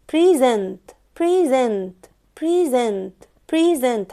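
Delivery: "present" drilled as pri-ZENT; stress on second syllable. Shown as a demonstration. Teacher raising her voice on the strong syllable, "present" as pri-ZENT. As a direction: push the ZENT of "present" each time. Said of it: "باكد على المقطع ده".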